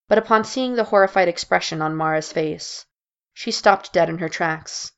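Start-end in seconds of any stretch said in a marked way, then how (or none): none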